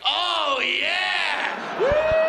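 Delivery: high-pitched, stretched-out lecherous cheer; whooping approval. Acted. Guys seeing a girl's dress down, whooping approval. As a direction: howl it like a delighted crowd of guys. Men cheering at undressed woman.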